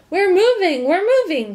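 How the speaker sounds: high, loud joyful shout; happy shout. A narrator's reading. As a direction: cry out in delight as the car rolls. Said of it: "he shouted happily".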